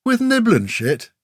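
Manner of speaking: very high incredulous scoff; disbelief. A narrator's reading. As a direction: squeak up in scornful disbelief. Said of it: "frowned at him in disbelief".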